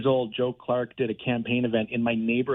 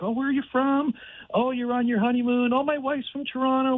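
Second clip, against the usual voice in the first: much higher, airy imitation of the star; Jeff Goldblum; 11 semitones higher than usual. Star chatting with honeymooners, high imitated voice. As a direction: play the chatty star, pitched way up. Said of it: "He's like".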